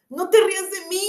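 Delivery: high, loud furious howl. A narrator's reading. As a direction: howl it out in rage. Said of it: "aulló la bestia".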